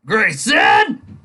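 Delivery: loud, high bellow of a name; roar. Acted. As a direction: roar it across the office. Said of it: "his boss roars".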